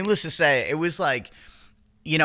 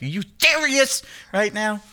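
higher, disbelieving outburst; incredulous; 9 semitones higher than usual. Retold or imitated. Reacting to Felix eating the cinnamon rolls, higher and disbelieving. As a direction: sound like you can't believe your eyes.

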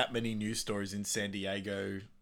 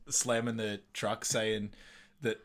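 ordinary talk, nothing marked

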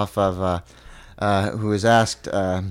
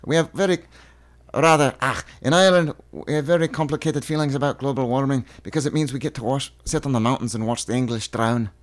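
put-on Celtic accent, lilting; Frankie Boyle. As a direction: deliver the joke in a Celtic accent. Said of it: "the one from, uh, Frankie Boyle".

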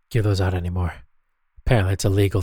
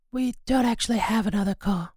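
high silly sidekick voice; 11 semitones higher, 4 dB quieter than usual. Acted. Second host voiced high and odd.

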